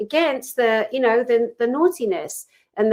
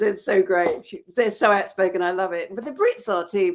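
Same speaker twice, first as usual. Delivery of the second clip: usual speaking voice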